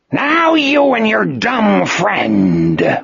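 high, slow, drawn-out menacing cry; villainous scream. A narrator's reading. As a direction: scream it in triumph, stretching the words. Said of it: "Morgan screamed".

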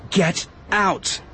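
high-pitched command, not fully shouted; two separate, urgent high words; desperate order. A narrator's reading. Obi-Wan forcing villagers to move, desperate order.

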